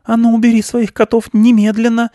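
high, loud stern aunt; strict aunt mouse command. A narrator's reading. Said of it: "строго сказала мыши тётя".

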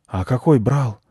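put-on groggy, puzzled boy; bewildered. A narrator's reading. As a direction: ask in mild sleepy confusion. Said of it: "растерянно спросил не до конца проснувшийся мальчик".

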